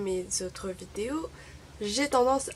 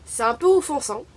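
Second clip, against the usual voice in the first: louder, higher, mildly offended; indignant retort; 5 semitones higher, 10 dB louder than usual. Real, not acted. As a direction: push back, clearly offended. Reply to an offensive question. (un peu offensée, plus fort et plus aigu)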